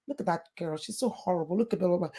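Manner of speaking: quiet, catty schoolkid taunt; hushed, sneering playground gossip; mean gossip voice. Retold or imitated. Retelling a friend's mean gossip.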